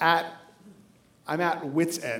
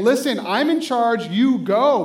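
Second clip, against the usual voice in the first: commanding Jesus; 4 semitones higher, 7 dB louder, slower than usual. Retold or imitated. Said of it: "like Jesus saying".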